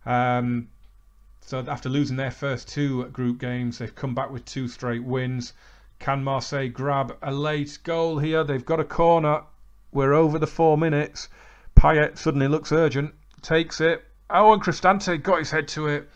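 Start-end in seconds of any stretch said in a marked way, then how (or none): 6.0-16.1 louder and louder commentary for the late corner; building play-by-play. Acted. Calling the last-minute corner, louder and urgent. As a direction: build the tension to the header.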